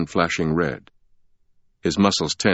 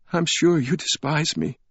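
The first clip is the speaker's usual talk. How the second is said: higher, tearful voice; a touch of a sob in a higher voice; sobbing; 9 semitones higher than usual. A narrator's reading. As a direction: plead through tears, lightly voiced. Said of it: "she sobbed".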